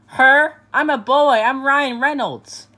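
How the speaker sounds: loud, high offended objection; indignant protest. Acted. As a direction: insist you're a boy, outraged. Objecting to being called her.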